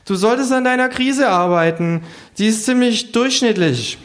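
hohe, trocken urteilende Figurenstimme; hoch und belehrend raten; Känguru. Acted. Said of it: "sagt das Känguru". (hohe Känguru-Stimme)

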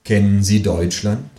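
slow, measured recitation with long pauses; solemn amateur poetry recital; declaiming a poem. Acted. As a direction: declaim it line by line, very slowly. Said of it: "Ich deklamiere".

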